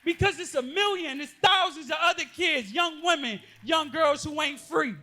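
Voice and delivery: preacher to abused youth in the room, loud and impassioned; full-force preaching. Acted. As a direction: cry it out to the congregation.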